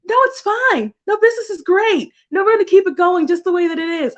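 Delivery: a bright, fast hammed-up "business is great"; mock denial. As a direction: insist it's all fine in a high put-on cheer. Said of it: "I'm just like".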